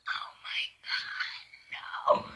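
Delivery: breathless horrified whisper; shocked whisper. Real, not acted. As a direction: whisper it in disbelief.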